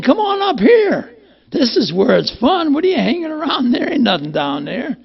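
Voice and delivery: high, light voice of his daughter calling him up, close to crying; a daughter's voice pitched high, calling down to him. Retold or imitated. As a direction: call down to Dad from heaven, bright and teary.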